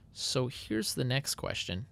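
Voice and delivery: normal talking voice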